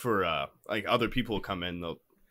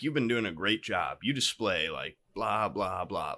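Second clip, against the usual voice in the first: put-on old man's praise; 8 semitones lower, 9 dB quieter than usual. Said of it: "He's like".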